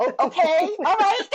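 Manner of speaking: okay breaks into a high laugh, stunned; laughing disbelief. Retold or imitated. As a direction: laugh your way through accepting the news. Shocked acceptance of two kids.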